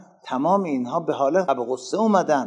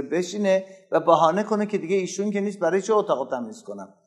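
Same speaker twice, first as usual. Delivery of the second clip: normal talking voice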